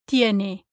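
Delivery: "tiene" with tongue touching the back of top teeth; soft dental T in "tiene", no puff of air; soft Spanish T. Shown as a demonstration. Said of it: "with your tongue touching the back".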